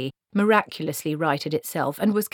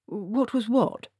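slow, higher puzzled question; bewildered; 6 semitones higher, slower than usual. A narrator's reading. Wizard answering Granny's sudden question, bewildered. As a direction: ask it completely at a loss.

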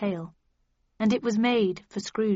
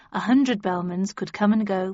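ordinary delivery

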